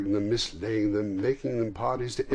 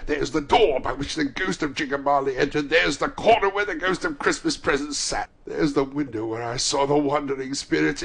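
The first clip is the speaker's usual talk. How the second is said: raised, louder breathless discovery; excited cry; 6 semitones higher, 7 dB louder than usual. A narrator's reading. Scrooge going round the fireplace, crying out excitedly. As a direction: point out each thing with rising excitement. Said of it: "cried Scrooge, starting off again".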